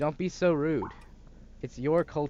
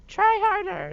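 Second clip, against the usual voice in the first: much higher, louder mocking mom impression; 8 dB louder than usual. Son mocking his mother's nagging in a high voice. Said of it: "is what she would say".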